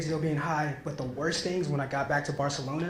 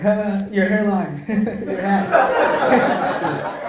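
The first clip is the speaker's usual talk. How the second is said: laughing tease; 5 semitones higher, 7 dB louder, much slower than usual. Retold or imitated. Joking at friends in the car.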